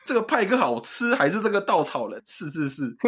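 host keeps parodying 杨凡 weighing the two chicken shops; 杨凡 impression. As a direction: ask the choice like 杨凡, then trail off agreeing. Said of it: "你要学杨凡吧".

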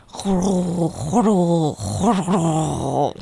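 slow whispered purr; cat purring. Shown as a demonstration. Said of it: "那我们一起来学好不好". (像小猫一样轻轻呼噜)

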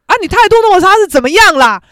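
mock indignant shout; imitated angry retort. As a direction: call out their attitude, acting mother's anger. Mother retorting to rude woman.

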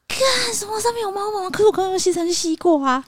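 high, whiny mock alarm with a cry in it. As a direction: wail it in comic disbelief. Shocked about cat hair.

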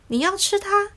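the lemon startled that the sparrow will eat the caterpillar. Acted. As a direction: gasp it out in shock. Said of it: "柠檬先生才吓了一跳".

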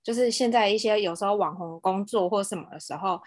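normal talking voice

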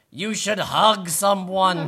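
high, loud, slow snake voice; boa constrictor voice. A narrator's reading. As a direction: coax it as the hugging snake. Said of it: "Boa constrictor hugging this rabbit".

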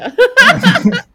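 loud burst of laughter. Real, not acted. Teasing laugh after threat.

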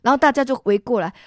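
ordinary delivery

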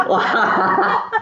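高声爆笑后惊叹; 笑到停不下来; 放声大笑. Real, not acted. 回想惨痛经验大笑. (忍不住高声大笑)

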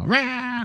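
high startled wah; a quick high scare cry; mock yelp of fright. Fleeing in fright.

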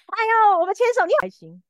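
high squeak that breaks then drops; mock-giddy line that cracks mid-phrase; voice cracking. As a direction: let the voice crack on the excited start. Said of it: "我们破音啊".

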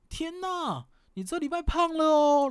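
high, loud mock-shocked gasp at the scale; fake-shocked coach. Coach loudly announces the weight gain during weigh-in, high mock shock. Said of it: "假装很大声".

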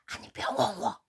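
breathy hushed secret; boss's conspiratorial whisper. Retold or imitated. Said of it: "他就走，走到我旁边".

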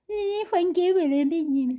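high, slow scolding voice; nagging manager. Retold or imitated. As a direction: drag it out like a bossy manager. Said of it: "一直讲这种". (拖长音训话的店长)

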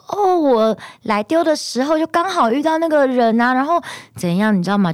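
high, breezy mock mother making excuses; imitating mom. Mom excusing herself, voiced.

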